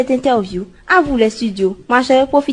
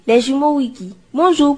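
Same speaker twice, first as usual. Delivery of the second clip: ordinary talk, nothing marked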